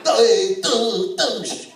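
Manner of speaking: loud, high jeering hey douche; mock New York heckle.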